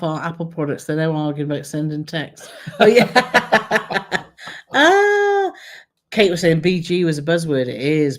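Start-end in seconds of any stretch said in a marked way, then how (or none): 2.8-4.3 laughing. Real, not acted. Laughing at the argument. 4.7-5.5 delighted. Real, not acted. High delighted exclamation.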